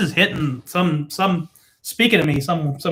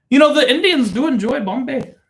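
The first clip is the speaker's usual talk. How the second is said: mock Indian accent; 7 semitones higher than usual. Accented joke about imperial Britain.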